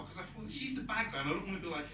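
ordinary talk

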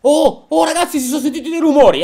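loud, high, hurried alert; sudden high outburst; startled alarm. Real, not acted. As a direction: call out to the others in alarm. Startled by the noises.

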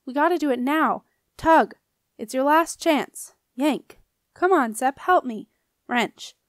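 shouted bursts of exertion; urgent yelling. A narrator's reading. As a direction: call for help mid-struggle. Nico urges help while throttling Marcellus.

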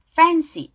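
"Fancy" with a clearly made F; careful F sound. Shown as a demonstration. Teacher models a word for learners, careful F sound. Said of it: "F 발음 주의하시기".

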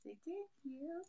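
high, soft coaxing to a dog; pet talk. Real, not acted. Coaxing the dog to say thank you, high soft pet talk. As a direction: coo it to the puppy.

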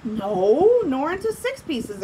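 mildly offended lion refusing; indignant lion. Acted. Lion refusing the tiger, mildly indignant. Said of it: "answered the lion indignantly".

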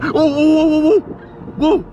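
high startled whoas; startled yelps. Real, not acted. Vlogger yelps at the drone crash, high and startled. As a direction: yelp in shock as the drone goes down.

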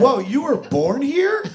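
high-pitched, drawn-out disbelief; retold astonishment. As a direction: react as if hit by a plot twist. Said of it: "I was like, "What?".

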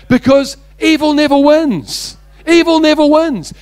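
high, loud, ringing declaration; emphatic preaching. Real, not acted. Preacher drives home his message to the congregation, emphatic and loud. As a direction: proclaim it to the whole congregation.

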